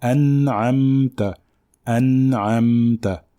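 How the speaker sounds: an'amta slow, nun and mim unvowelled; an'amta said slowly with sukun; correct sukun. Shown as a demonstration. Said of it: "on dit an'amta".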